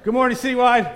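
high, loud call to the crowd; rousing shouted welcome; shouted greeting. Real, not acted. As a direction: holler a big hello to the congregation. Calls out greeting to the congregation.

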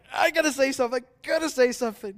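very high, loud comic squeal of urgency; hammed-up high-pitched pleading; mock urgent outburst. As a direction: act out bursting to speak, high and loud. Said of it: "I'm just like".

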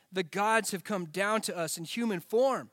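ordinary talk, nothing marked

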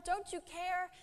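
high, desperate cry; panicked disciples. Acted. Disciples in the sinking boat begging Jesus, high panicked cry. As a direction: plead in alarm, voice pitched high. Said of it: "shook him awake violently, saying".

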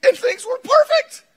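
high, loud preaching build; impassioned pulpit preaching. Acted. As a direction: preach it out with full force. Preaching at full force.

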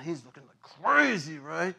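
usual speaking voice

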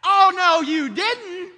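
loud, high, indignant "oh no you didn't"; a crowd snapping back in disbelief; scoffing retort. Acted. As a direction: fling the denial back at him.

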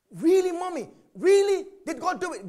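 put-on high, excited child asking; child's voice. Child asking mommy, high excited voice. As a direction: ask like an amazed little kid.

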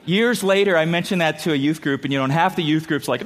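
ordinary talk

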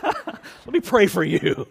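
slow, laughing mock-pious line; sarcastic blessing. Pastor joking how he'd answer the cashier, sarcastic. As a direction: drip fake piety.

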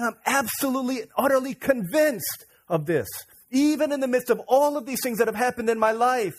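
loud, high impassioned claim; fervent preaching. Acted. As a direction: proclaim it with total conviction.

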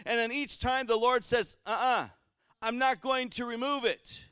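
loud, high preaching at full force; fiery sermon voice. Acted. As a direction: preach it to the back pews.

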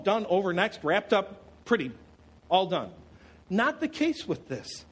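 normal talking voice

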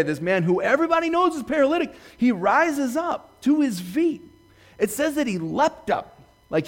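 high, animated excitement; excited retelling. Retold or imitated. Acting out healed man's excitement.

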